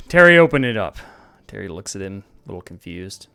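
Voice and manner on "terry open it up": loud call across the bar; barking an order across the room. Acted. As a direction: call him over, raised voice. Big calls the bartender over.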